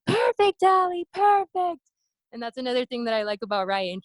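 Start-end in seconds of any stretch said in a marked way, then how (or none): 0.1-1.8 high, loud cheer of perfect from the crowd; coach cheering. Retold or imitated. Coach Ryan shouting from the crowd, high and cheering. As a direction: cheer her on like a proud coach. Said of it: "I could just hear Ryan".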